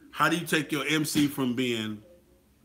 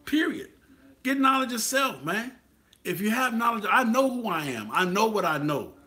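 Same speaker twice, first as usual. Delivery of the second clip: a raised, rallying declaration; fired-up emphasis; 8 semitones higher than usual. Real, not acted. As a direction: drive the point home, fired up. Preaching emphatic climax.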